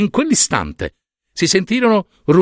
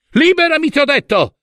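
high-pitched imperious cry; shrieked order, out of patience; angry command; 12 semitones higher than usual. A narrator's reading. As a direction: demand it at the top of the voice. Hera orders son again.

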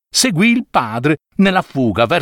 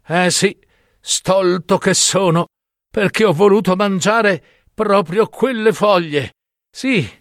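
dying lament; 6 semitones higher than usual. Acted. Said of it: "mentre stava proprio per morire".

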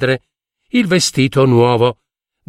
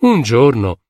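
ordinary delivery, nothing marked

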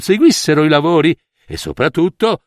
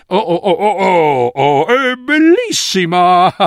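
feigned rapture; 4 semitones higher, slower than usual. Acted. Emperor fakes delight at cloth.

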